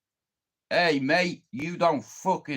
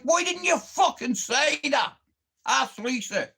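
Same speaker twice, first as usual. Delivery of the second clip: exasperated retort; 8 semitones higher than usual. Retold or imitated. Retold exasperated retort to repairman.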